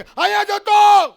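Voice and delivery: high, drawn-out mock shout; hammed-up defensive yell; husband yelling back. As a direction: holler it back, stretched out. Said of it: "તો ઓલો એ પાછો કે".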